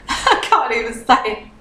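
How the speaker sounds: giggling through the words; laughing, a bit tipsy. Real, not acted. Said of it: "I've started to get tipsy".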